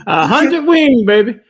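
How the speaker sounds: high, booming hyped-up shout; loud crowing. Acted. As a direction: holler it like a boast. Crowing back the played clip.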